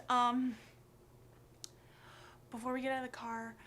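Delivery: usual speaking voice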